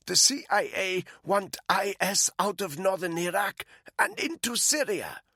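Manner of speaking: a frightened captive pleading; captive woman's voice. Acted. Malinka continues under the rope.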